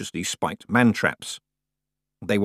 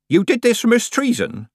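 higher, louder questioning character voice; Nanny's voice; 5 dB louder than usual. A narrator's reading. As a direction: voice Nanny as a pointed, raised question. Nanny questions Tiffany outside.

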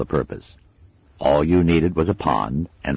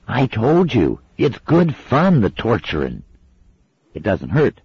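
put-on coaxing girl; 9 semitones higher, 5 dB louder than usual. A narrator's reading. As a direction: sell it as fun to the little one. Pepper coaxing.

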